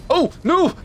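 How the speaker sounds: a startled squeal at his own accidental fireball; 1 shocked squeal. Real, not acted. Burst out in shock after hitting a friend with a fireball.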